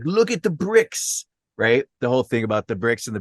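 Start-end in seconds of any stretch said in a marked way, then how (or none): 0.0-1.2 high, drawn-out mimicry of a critic; mocking imitation. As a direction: stretch it out high, teasing at talking volume. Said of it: "he's like".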